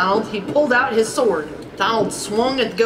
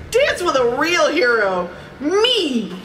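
high, proud storybook flourish; boastful; 5 semitones higher, slower than usual. A narrator's reading. Donald invites Daisy to dance, boastful. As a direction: show off, landing high on the last word.

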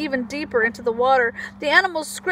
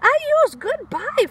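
very high peeping goodbye; high little frog peep; froggy peeps; 10 semitones higher, slower than usual. Acted. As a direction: peep it out cheekily. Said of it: "Froggy peeps".

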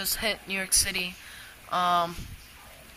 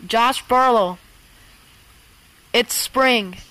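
weather alarm voice. Acted. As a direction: announce the storm like an automated alert. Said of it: "the voice just sounds like me".

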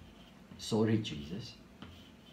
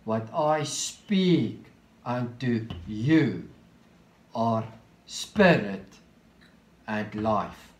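very slow, each phrase set apart; slow reading; 4 semitones lower, much slower than usual. Shown as a demonstration. Preacher reading the verse slowly so the congregation sees it. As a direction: lay out every word with a pause after it. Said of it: "I'm going to read it slowly now".

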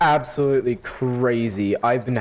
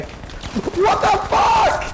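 high, slow, stretched-out outraged yell; drawn-out high yell of frustration; outraged shout; much slower than usual. Real, not acted. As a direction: scream it in disbelief. Killed right after respawning.

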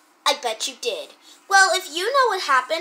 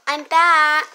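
louder call; 7 dB louder than usual. Acted. Sister arriving home, called again.

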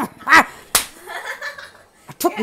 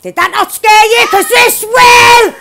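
shouted threat; 5 semitones higher, 7 dB louder than usual. Real, not acted.

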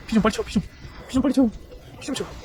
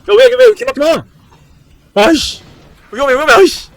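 frantic loud yells, higher pitch; panicked yelling; 7 semitones higher, 5 dB louder than usual. Real, not acted. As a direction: yell in panic at the screen. Panicking as monster attacks.

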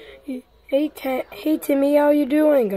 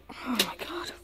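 much softer, worried whisper; 16 dB quieter, faster than usual. Acted. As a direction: hush it, sensing trouble. Finding crystals, uh-oh.

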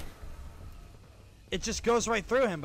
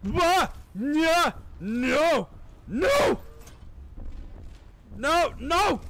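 panicked yelling; 6 semitones higher, slower than usual. Real, not acted. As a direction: yelp and shout in frustration mid-fight. Panicked yelling during game attack.

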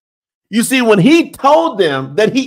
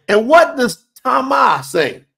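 ordinary talk

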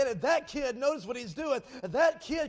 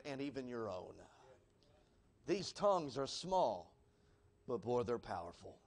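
quiet, grave warning, sinking lower as it closes; hushed solemn appeal; 6 semitones lower, 6 dB quieter than usual. Real, not acted. Preacher warning the congregation about the tongue, hushed and solemn. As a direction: drop low and soft, with weighty pauses.

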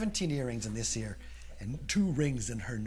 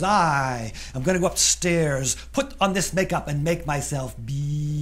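louder, bright-eyed plan; proud eager girl; 5 semitones higher, 9 dB louder than usual. Acted. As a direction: announce it like the best idea ever. Julie proudly answers.

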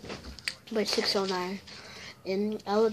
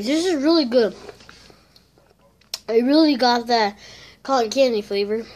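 loud, happy food praise; excited; 7 dB louder than usual. Real, not acted. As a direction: really sell how good it tastes. Raving about the ice cream.

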